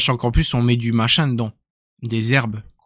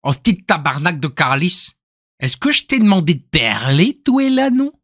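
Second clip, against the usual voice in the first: Canadian French accent; 3 semitones higher than usual. Shown as a demonstration. Streamer quoting a Québécois telling someone off, Canadian French accent. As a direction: put on an indignant Québécois accent. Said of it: "Le canadien, c'est".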